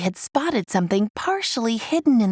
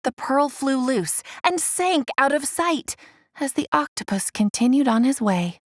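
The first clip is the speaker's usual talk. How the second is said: ordinary delivery, nothing marked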